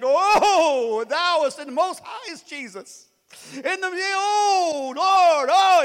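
loud, high, drawn-out pious wail; mock religious. Pastor mocking fake-religious praying, loud drawn-out. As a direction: ham up fake holiness, slow. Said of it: "try to get all religious".

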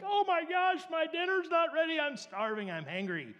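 high, loud mock tantrum that winds down; whining little kid. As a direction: wail in self-pity like a hungry child. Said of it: "like a little kid and cry and whine".

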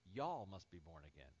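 "Y'all" said as one drawn Texan word, jɔl; Texas y'all. Retold or imitated. Preacher quoting Jesus to the congregation, Texan "Y'all". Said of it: "That's how they say it in Texas".

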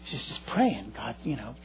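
soft, low imitated praying; imitating someone praying quietly to herself; under the breath. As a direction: drop to a private prayer aside. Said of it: "pray in tongues under my breath".

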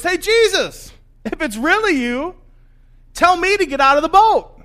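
high, loud shout across the water; calling out. Acted. Fisherman in the boat to Jesus on the lake, shouting. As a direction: holler to someone far off.

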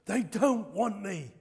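slow, forceful retold declaration; firm, weighty pronouncement. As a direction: quote it with force, each word drawn out. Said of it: "he said it with force".

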